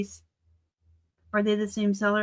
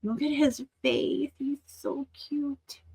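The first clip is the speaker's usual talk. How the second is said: slow, softer adoring coo; 5 semitones higher, 7 dB quieter, slower than usual. Real, not acted. Collector to viewers about Yoda's face, soft slow coo. Cooing at Yoda figure.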